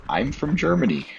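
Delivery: imitating a thick German accent, pitched high; a mock German mother's accent, high. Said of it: "in her thick German accent said".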